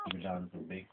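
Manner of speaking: usual speaking voice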